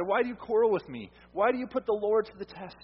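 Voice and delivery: a touch of Moses' pushback; exasperated Moses. Retold or imitated. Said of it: "Moses replied".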